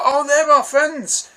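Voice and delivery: a light mock of the rescued man's gushing; high eager voice. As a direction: plead the case, high and eager. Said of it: "they're like".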